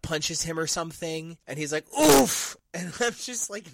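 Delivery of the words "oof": loud, high comic oof; a cartoon punched in the gut; mock grunt of pain. Pluto gut-punched by Angel, loud hammed-up oof.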